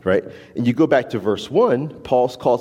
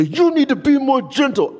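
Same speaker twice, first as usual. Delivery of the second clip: mock yell; 12 semitones higher than usual. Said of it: "I don't have to yell at you and say".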